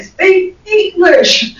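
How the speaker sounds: exasperated plea. Retold or imitated. Speaker begging Ian to drop Aussie slang, slow exasperation. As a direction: plead "Speak English" slowly, half-laughing. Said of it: "I have to say to Ian".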